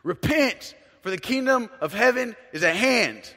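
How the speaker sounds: high, loud repent shout; imitating a stranger yelling repent to the street; street preacher yell. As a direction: bellow it like a street preacher at a crowd. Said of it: "he yelled at the top of his lungs".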